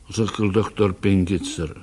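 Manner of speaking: ordinary delivery, nothing marked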